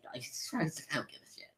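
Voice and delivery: whispered, slow throwaway aside; imitated whispered dismissal; indifferent whisper. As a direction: say it under your breath, unvoiced. Retold indifferent aside, barely voiced.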